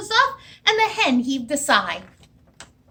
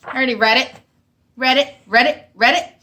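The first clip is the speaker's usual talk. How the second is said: louder froggy croak of read it; 7 dB louder than usual. Acted. Frog punchline croaked to the barnyard, a bit louder. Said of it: "You know what he said".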